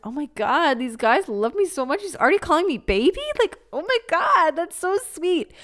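gushing. Retold or imitated. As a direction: squeal like a naive girl thrilled by a pet name. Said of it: "I was like".

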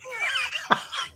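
sudden high burst of laughter; host laughing at guest's joke; surprised laugh. Real, not acted. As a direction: laugh out in delight. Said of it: "I guess so".

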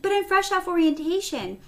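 high-pitched worried objection; retold anxious whine; pleading protest. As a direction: plead like a nervous newcomer. Acted protest to charge nurse.

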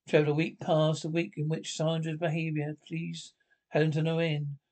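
ordinary talk

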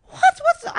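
high-pitched shocked cry. Retold or imitated. Friend squealing in shock hearing the news. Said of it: "she was, like, shocked".

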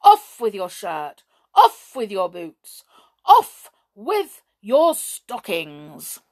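loud, stern orders, slowing at the end; stern voice laying down punishment; stern command. A narrator's reading. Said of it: "said a stern voice in his ear".

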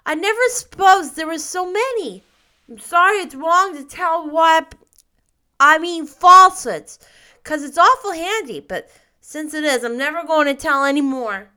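put-on high, loud child voice; earnest little boy Davy. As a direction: ramble it out like a contrite small boy. Davy resigns himself to honesty.

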